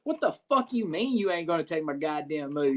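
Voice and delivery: mildly heated retelling of disbelief; heated younger self. Retold or imitated. Wrestler recalling his refused finisher, mildly heated. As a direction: re-enact your indignant younger self, lightly. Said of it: "twenty-one-year-old me saying".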